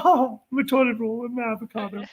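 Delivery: high, soft whiny wail; whiny mock voice. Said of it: "you're gonna be like".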